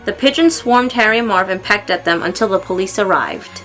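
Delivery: ordinary delivery, nothing marked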